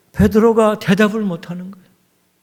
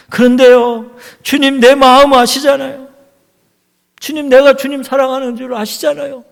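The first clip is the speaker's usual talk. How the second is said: louder, pressing plea; earnest plea; 5 semitones higher, 5 dB louder than usual. Acted. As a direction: insist he truly loves him. Peter pleads his love. (간절하게 힘주어 호소하듯)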